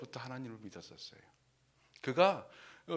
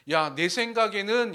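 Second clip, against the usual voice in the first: angry; 5 semitones higher, 9 dB louder than usual. Acted. Preacher quotes Naaman's complaint with acted anger. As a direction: act out an offended protest. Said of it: "나아만이 화를 내면서".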